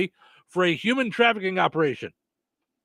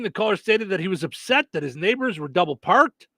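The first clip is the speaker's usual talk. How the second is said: ordinary delivery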